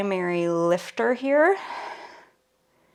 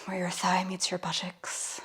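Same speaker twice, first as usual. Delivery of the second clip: whispered aside; 6 dB quieter than usual. Real, not acted. Cheeky aside about body part.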